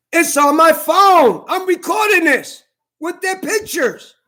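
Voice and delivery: retold shout. Confronting store staff, recording them.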